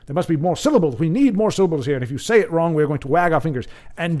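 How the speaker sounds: hammed-up British accent, laying down the rules; mock British accent. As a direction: lecture in a put-on British accent. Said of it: "I should be doing this with British accent".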